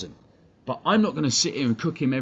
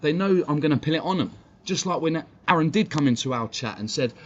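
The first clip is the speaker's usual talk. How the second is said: ordinary talk, nothing marked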